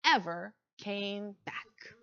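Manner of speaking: usual speaking voice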